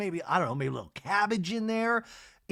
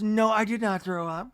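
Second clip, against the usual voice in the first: firm denial; 5 semitones higher than usual. Retold or imitated. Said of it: "And Mary goes".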